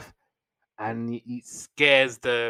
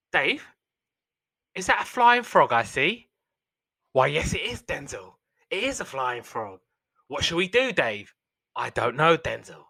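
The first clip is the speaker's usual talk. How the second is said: Snatch gangster banter; 3 semitones higher than usual. Retold or imitated. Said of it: "like they're in Snatch or something".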